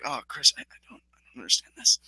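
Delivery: low, hushed imitation of suppressed hate; Kevin Owens. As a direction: mumble it quickly, holding back the loathing. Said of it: "trying to repress all of the hate".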